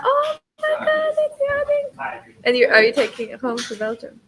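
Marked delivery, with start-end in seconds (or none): 0.0-2.2 very high, slow, squealing over the puppy; squealing coo. Real, not acted. Friend seeing the puppy yawn, high squealing coo. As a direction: gush like the yawn broke your heart.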